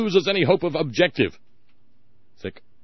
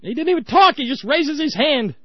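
exasperated shout; 14 semitones higher, 6 dB louder than usual. Real, not acted. Indignant outburst.

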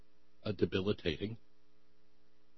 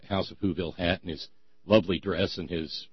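normal talking voice

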